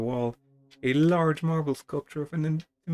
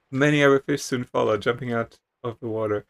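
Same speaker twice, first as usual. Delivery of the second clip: ordinary talk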